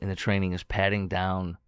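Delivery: normal talking voice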